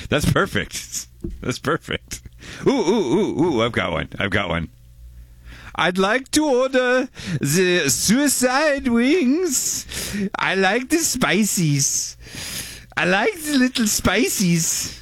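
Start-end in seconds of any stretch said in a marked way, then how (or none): 5.8-14.9 put-on high, very slow German accent. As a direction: boom it out in a thick German accent. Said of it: "Can you read a text in German".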